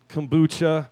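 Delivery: usual speaking voice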